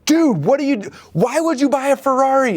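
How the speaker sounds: high-pitched, put-on wife voice; exasperated wife. Wife confronts husband over the Ferrari, high mock outrage. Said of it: "your wife's like".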